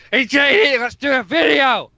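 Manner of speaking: high, eager pitch; mock eager Tommy. Imitating Tommy begging J D for a video, high and eager. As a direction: bounce in like an overexcited pest. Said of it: "Tommy's like".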